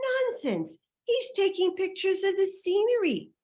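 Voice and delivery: very high, scoffing voice; dismissive woman. Retold or imitated. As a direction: wave it away as the high-voiced passer-by.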